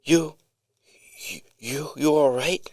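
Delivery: high, shaky stammering worry. Retold or imitated. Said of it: "in his shaky voice".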